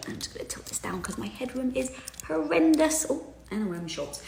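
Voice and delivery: quick whispered aside to herself. Real, not acted. Fixing her camera, she whispers an aside to viewers.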